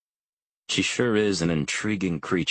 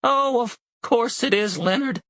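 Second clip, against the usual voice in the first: put-on much higher, louder old woman snapping back; snapping old mother; 4 dB louder than usual. A narrator's reading. Elderly mother to her husband, snapping back. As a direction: snap back at him indignantly. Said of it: "my mother snaps".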